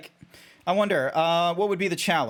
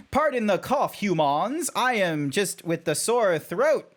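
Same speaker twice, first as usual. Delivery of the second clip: stilted, swooping mock-formal apology; over-enunciated furry character voice; random furry; 4 semitones higher than usual. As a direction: apologise like an odd, formal creature. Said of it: "a random furry is just like".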